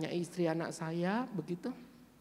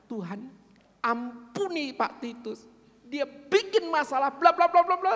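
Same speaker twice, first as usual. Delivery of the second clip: angry prayer; 6 semitones higher, 5 dB louder than usual. Retold or imitated. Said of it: "Dalam doa itu dia marah".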